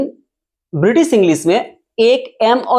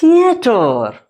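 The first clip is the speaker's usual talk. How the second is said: high, ringing dictation call; announcing example word; 5 semitones higher, much slower than usual. Shown as a demonstration. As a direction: call it out high, then let it fall. Teacher calls out the example word. (ऊँचे सुर में शब्द पुकारते हुए)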